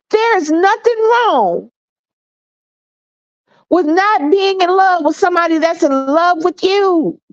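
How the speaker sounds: fighting back tears to reassure them; emotional high voice near crying; voice breaking high. Real, not acted.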